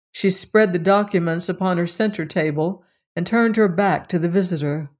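ordinary delivery, nothing marked

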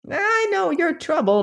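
put-on high, thin old crone voice; lightly croaked old woman offering help; old woman, sweetly. A narrator's reading.

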